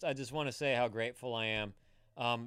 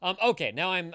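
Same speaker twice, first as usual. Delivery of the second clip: ordinary talk, nothing marked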